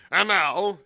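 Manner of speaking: suddenly loud, high resumption; aloud. A narrator's reading. Silver resuming before the whole tavern, aloud. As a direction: pick up again at full volume. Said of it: "he ran on again aloud".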